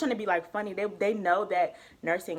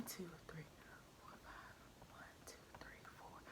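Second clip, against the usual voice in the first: whispered counting; 3 semitones lower, 28 dB quieter than usual. Real, not acted. Counting exams under her breath.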